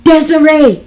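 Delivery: high, very loud shout; yelling the name. A narrator's reading. John yelling Desiree's name through the phone, alarmed shout. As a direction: yell her name in alarm. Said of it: "John yelling my name".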